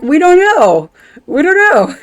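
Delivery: high, loud helpless shout; helpless yell. Retold or imitated. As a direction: throw up your hands and yell it. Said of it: "And we're like".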